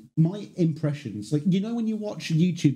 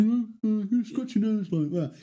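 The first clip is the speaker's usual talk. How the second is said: raised sing-song Liverpudlian; 6 semitones higher than usual. Shown as a demonstration. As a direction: talk higher, as with a scrunched nose. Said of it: "helps in doing the [name] impression".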